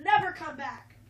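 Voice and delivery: loud, final command; friend's parents on the porch, loud and final; harsh dismissal. Acted. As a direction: throw him out with it. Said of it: "and they said".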